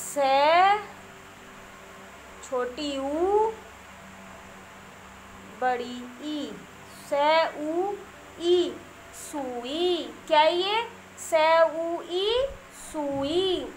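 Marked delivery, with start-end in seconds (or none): 0.1-8.7 slow letter-by-letter dictation with pauses; spelling out slowly. Shown as a demonstration. Teacher spelling a word for young children, slow dictation. 11.2-12.5 slow letter-by-letter dictation with pauses; spelling out slowly. Shown as a demonstration. Teacher spelling a word for young children, slow dictation.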